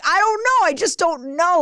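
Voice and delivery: very high, helpless mock wail; exasperated high-pitched defence; flustered protest. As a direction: act out being scolded and at a loss. Acted quarrel with angry husband.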